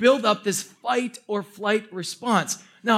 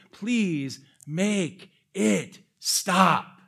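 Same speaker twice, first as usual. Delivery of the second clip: slow plea; much slower than usual. Retold or imitated. Pleading thought, drawn out.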